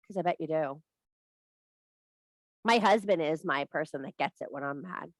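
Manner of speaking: ordinary delivery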